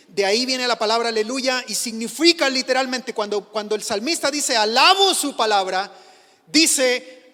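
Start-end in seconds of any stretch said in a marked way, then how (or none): 4.5-5.9 much louder, calling the verse out; loud proclaiming. Shown as a demonstration. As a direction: proclaim it to the whole church. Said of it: "Hablo fuerte, grito su palabra". (proclamando a gran voz)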